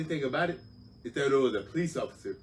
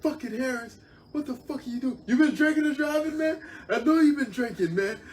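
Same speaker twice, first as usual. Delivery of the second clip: barking sergeant; 9 dB louder than usual. Retold or imitated. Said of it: "starts barking at me".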